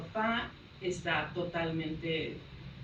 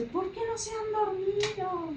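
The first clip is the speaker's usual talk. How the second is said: scolding mother; 13 semitones higher, slower than usual. Retold or imitated. Mother scolding late at night.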